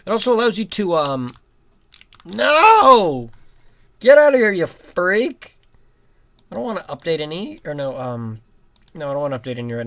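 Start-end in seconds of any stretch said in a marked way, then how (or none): 2.2-5.6 loud, higher shout at an enemy; yelling at the game. Real, not acted. Gamer yelling at an enemy on screen. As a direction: chase the enemy off with a yell.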